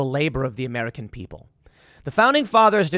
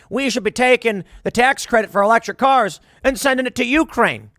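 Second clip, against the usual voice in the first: Lindsey Graham; 6 semitones higher, 5 dB louder than usual. Retold or imitated. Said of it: "He's like".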